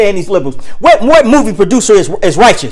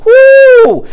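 excited whoop; 12 semitones higher, 4 dB louder than usual. Real, not acted. Preacher reacts to the verse.

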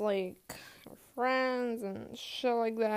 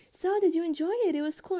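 higher, louder voice for mom; imitated mom; 7 semitones higher, 5 dB louder, faster than usual. Mom asking after the outing, higher imitated mom voice. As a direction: chirp the question like an eager parent.

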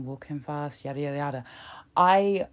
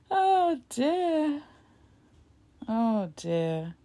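high-pitched laugh into oh dear; 9 semitones higher, much slower than usual. Real, not acted. Vlogger laughs at Truss's press conference. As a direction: laugh helplessly at the clip.

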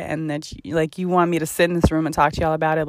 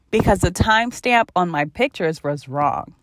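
ordinary talk

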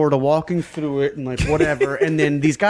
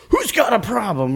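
higher-pitched mock aggression; retold challenge; 7 semitones higher than usual. Skinhead squaring up to ten guys, mock-belligerent challenge. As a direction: imitate someone picking a fight.

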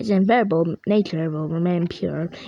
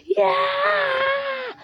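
high cartoon yell. Character cries out.

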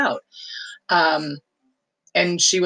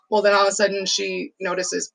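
usual speaking voice

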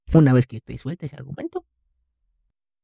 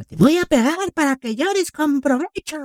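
higher, slow mock threat from mom; threatening mom; 12 semitones higher, slower than usual. Son imitating mom threatening her crying child, high and slow. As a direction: sing out mom's threat to a crying kid. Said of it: "Y de momento yo chillaba".

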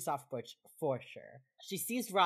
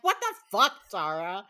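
mock outraged yell; 2 semitones higher, 9 dB louder than usual. Retelling Emily punching Tara in the arm, mock outraged. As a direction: yell it as an indignant retold confrontation.

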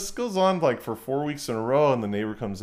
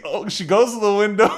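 normal talking voice

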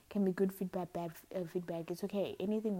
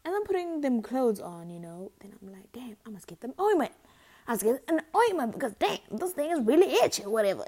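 loud, higher, exasperated; loud frustrated complaint; 5 semitones higher, 10 dB louder than usual. Retold or imitated. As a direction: complain loudly about the itch. Retold inner reaction, loud.

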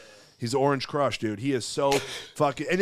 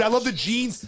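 normal talking voice

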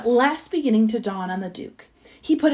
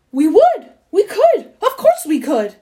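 high, loud eager chorus; loud, bursting with keenness; excited shouting; 5 semitones higher, 7 dB louder than usual. A narrator's reading. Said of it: "we all shouted".

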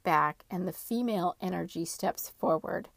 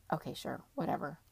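lower, softer giving in; resigned; 4 semitones lower, 7 dB quieter, faster than usual. Retold or imitated. Worn-out sleeper agreeing to roll over, resigned. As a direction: give up with a dismissive murmur.

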